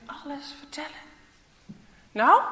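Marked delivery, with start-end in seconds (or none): 2.1-2.4 sharp demanding nou. Acted. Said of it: "klinkt het".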